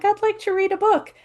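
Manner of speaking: put-on a shy inner child asking to read; tiny timid voice. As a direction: squeak it out like a shy little girl. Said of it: "here's Little Sage, just like".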